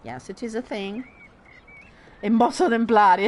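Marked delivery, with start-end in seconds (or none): 2.2-3.3 loud, fast Roman-accented Italian. Shown as a demonstration. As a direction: throw it out loudly in Roman dialect. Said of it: "I start to speak in Roman".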